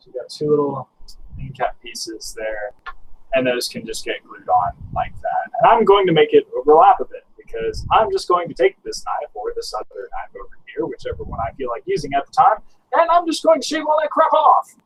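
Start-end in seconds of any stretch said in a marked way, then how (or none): 5.6-14.7 upbeat salesman pitch; used car salesman. Real, not acted. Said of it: "You sounded like a used car salesman right there".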